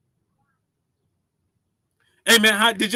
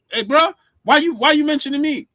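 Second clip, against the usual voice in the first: high, loud and offended; loud mock outrage at being named; indignant shout; 9 semitones higher, 6 dB louder than usual. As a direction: call him out loudly, affronted. Shouting back at the rival.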